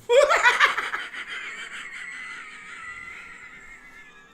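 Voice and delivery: long laugh. Real, not acted. Joke about the salty milk.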